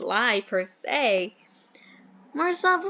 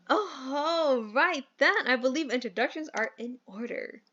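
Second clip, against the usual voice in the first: ordinary delivery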